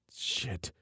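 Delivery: breathless whispered curse; hushed dismayed curse; muttered curse. A narrator's reading. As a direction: curse to himself under breath. Trenton curses under breath.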